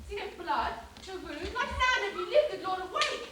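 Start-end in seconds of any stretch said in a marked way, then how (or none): 1.4-3.3 louder, rising call to wake him. Acted. Helena calls to Lysander on the ground.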